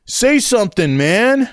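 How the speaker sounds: put-on high, insistent teenage voice; pushy teen. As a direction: prod a friend, voice pitched high. Teen pushes his friend.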